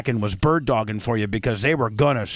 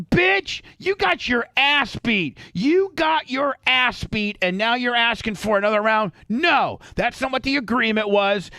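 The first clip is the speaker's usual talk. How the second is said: higher, mock-furious rant, slowed on "you got your ass beat"; retold angry outburst; 8 semitones higher than usual. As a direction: act out telling him off, heated and high. Retold furious reply to fighter.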